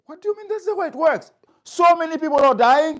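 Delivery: retold angry retort to best friend; indignant outburst. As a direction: fire it back in disbelief. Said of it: "I was so angry".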